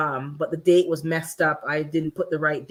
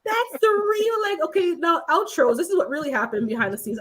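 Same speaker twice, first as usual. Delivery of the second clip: excited; 10 semitones higher than usual. Retold or imitated. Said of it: "I was like".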